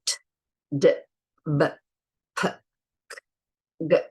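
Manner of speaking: each stop said alone, crisp; isolated stop consonants t, d, b, p, k, g; stop sounds. Shown as a demonstration. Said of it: "Those are the six stops".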